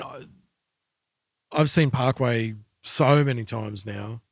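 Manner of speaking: normal talking voice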